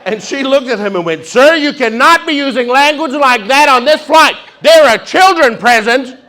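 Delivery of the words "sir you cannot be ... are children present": high, loud, furious scolding; outraged stewardess shout. Retold or imitated. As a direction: blast him like an offended stewardess.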